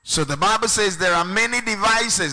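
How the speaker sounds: high-pitched, forceful preacher delivery; impassioned preaching. Real, not acted. Preacher expounding a scripture to the congregation, impassioned. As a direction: preach it out, voice lifted well above talk.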